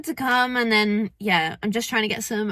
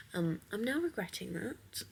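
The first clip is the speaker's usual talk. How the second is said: usual speaking voice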